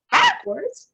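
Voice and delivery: high, loud disbelieving "Backwards?"; incredulous cry. Acted. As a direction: toss "Backwards?" back in shock, high and loud. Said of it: "cried the president".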